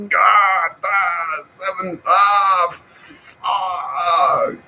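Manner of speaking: high, strained mock howl; mock anguished cry. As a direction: wail at the seven-dollar price. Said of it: "I'm just screaming out".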